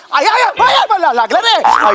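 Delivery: high loud ouch yelps; cries of pain. Acted. Photographer struck by angry husband, loud pained yelps. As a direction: yelp like you've just been struck.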